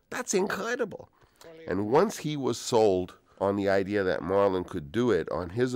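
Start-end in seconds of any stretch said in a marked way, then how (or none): 0.1-1.1 even higher, awestruck repeat; astounded, repeated. Retold or imitated. Studio boss repeating himself at the footage, awestruck and high. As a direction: say it again, still stunned. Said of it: "Charlie is just looks astounded and he says".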